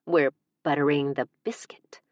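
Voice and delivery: teasing whisper; a cheeky whisper. Acted. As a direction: murmur the innuendo as a secret. Whispered innuendo.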